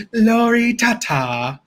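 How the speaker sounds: Moira Rose. Acted. Impression addressed to Lori, slow theatrical diva voice. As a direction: draw it out with affected grandeur. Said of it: "Moira would say Lor-, Lori Tata".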